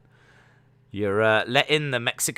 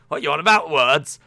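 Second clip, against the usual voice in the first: mock indignant voice; 4 semitones higher, 6 dB louder than usual. Mock confused retort, funny voice.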